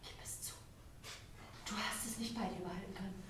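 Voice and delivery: low, fast, hissed whisper; whispered accusation. Acted. Queen hisses at her lady to confess, in a low whisper.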